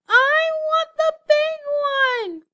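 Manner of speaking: slow, high, sing-song scream. A narrator's reading. Hare screaming for the biggest present, slow high. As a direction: wail it out greedily. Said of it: "screamed the hare".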